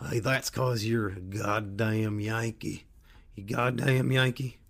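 slow Southern drawl, imitating a local; good old boy. Local good old boy teasing a northerner, in a slow Southern drawl. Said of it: "these good old boys told me".

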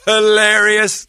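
drawn out high hilarious. Real, not acted. Laughing retelling.

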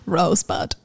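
a laughing Citizen Kane quote. Acted. Teasing a friend, laughing Rosebud. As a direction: whisper it through a laugh.

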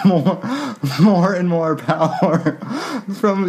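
words through laughter; laughing while reading. Real, not acted. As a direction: read it cracking up.

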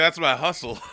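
laughing. Retold or imitated. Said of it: "Be like".

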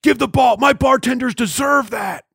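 high mock shout into a bar mic; mimicked yell. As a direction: mockingly imitate the man yelling at the coach. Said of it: "Why are you yelling at the coach".

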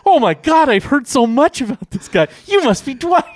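high, loud starstruck gushing; squealed, over-the-top thrilled impression; imitated excitement. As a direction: act out an overexcited fan meeting someone. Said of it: "He's so excited".

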